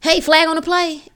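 high, playful attention call; body calling out. Acted. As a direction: call "hey" like a body flagging trouble. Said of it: "It's trying to say".

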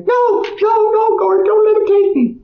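high, panicked pleading in a woman's voice; frightened woman. Retold or imitated. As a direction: beg not to be taken, voice jumping way up. Frightened woman resisting volunteering.

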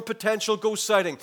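ordinary talk, nothing marked

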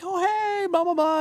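very high, bubbly hello; friendly greeting. Retold or imitated. Singer greeting a new artist, very high bubbly voice. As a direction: mimic a cheery star saying hi. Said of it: "She was like".

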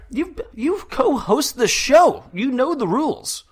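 high-pitched, drawn-out exasperated scolding; mock scolding. Host chiding co-host over the show's rules, mock scolding. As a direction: scold in an exaggerated squeaky-high voice.

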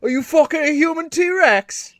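high, loud mock English accent, hammed up; a crude English accent at full volume, shouting. Host mocking a T-Rex in English accent. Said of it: "English accent".

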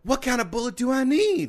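put-on high eager kid voice; eager kid asking; child voice. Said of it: "sent us a recording".

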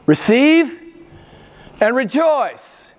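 high, loud, slow rejoice; ringing proclamation. Acted. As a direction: call it out in triumph.

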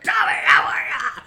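high, loud imitated hello; hammed-up nice guy shouting hello; overfriendly greeting. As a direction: call out a big friendly greeting. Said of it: "he's such a nice guy".

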